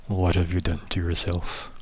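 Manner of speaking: low, soft, ominous question; unseen figure's voice. Acted. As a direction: drop low and quiet as the unseen voice. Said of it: "you just hear the voice say".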